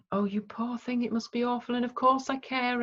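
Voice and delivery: high, syrupy mock pity; overdone sympathy. Shown as a demonstration. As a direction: gush over them as if they were fragile. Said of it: "Don't do the".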